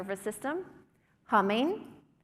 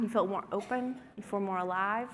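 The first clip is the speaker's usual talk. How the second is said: usual speaking voice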